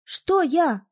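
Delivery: high-pitched indignant question. A narrator's reading. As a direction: throw the challenge straight back. Challenged back during quarrel.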